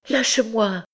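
high-pitched, desperate demand; frantic. A narrator's reading. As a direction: order him off in a high, distraught voice. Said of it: "Éperdue".

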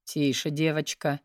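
low, soft, slow menacing man; a cold warning, low and metallic. Acted. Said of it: "Его голос меняется, становится ниже и приобретает металлический оттенок".

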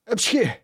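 sharp, high-pitched sneeze; big high sneeze. A narrator's reading. Half-drowned boy sneezes out water.

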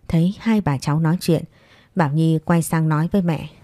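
ordinary delivery

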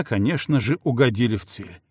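plain reading voice

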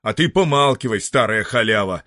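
громко, резко обрывает; осади старуху; оборвал грубо. Acted. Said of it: "оборвал её". (скорняк грубо обрывает старушку)

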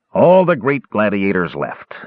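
usual speaking voice

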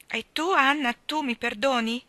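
high, loud pleading question; pleading little boy. A narrator's reading. Davy asking Anna to forgive him, pleading little boy. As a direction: beg forgiveness like a small child.

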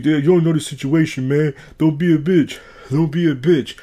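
mock aggressive street challenge; imitated yelling. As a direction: mimic an angry man picking a fight. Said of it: "Why are you yelling at me?".

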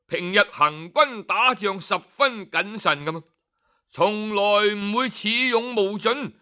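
ordinary delivery, nothing marked

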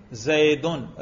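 زیدن ending in "-un", as zaydun; nominative ending. Shown as a demonstration. Teacher shows students the nominative case, زیدن pronounced zaydun. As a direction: say زیدن with a final u-n sound. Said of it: "میرے تلفظ سے ہی آپ کو پتہ چلے گا".

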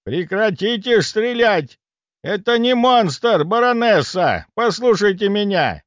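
high, slow, urgent shouting; отчаянный крик. A narrator's reading. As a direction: shout to make her stop, stretching the words. Дед кричит, останавливая стрельбу баронессы. (кричит, останавливая стрельбу)

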